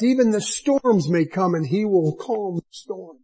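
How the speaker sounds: usual speaking voice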